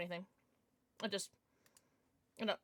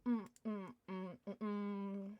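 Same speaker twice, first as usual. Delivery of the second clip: slow mm while chewing; savouring mouthful; 3 semitones lower, 8 dB quieter, slower than usual. Real, not acted. Streamer eating an Uncrustable on stream, savouring. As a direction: hum over a bite of food.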